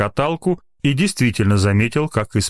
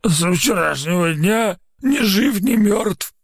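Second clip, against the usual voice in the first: put-on slow, higher, rasping drunk; hoarse hungover voice; 10 semitones higher, slower than usual. A narrator's reading. Hungover lab assistant answering the frightened doctors hoarsely. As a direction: croak it out half-dead from drink. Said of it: "хрипло ответила голова".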